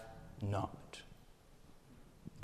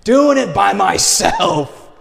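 loud, high and slow, every word hammered; emphatic preaching; 7 semitones higher, 11 dB louder, slower than usual. Acted. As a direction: declare it with a pulpit punch. Preaching American self-reliance, emphatic.

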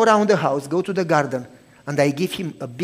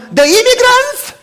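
high, loud shocked shriek; screaming wife; 12 dB louder than usual. Retold or imitated. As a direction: shriek it down the phone in alarm. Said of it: "she screams in the phone".

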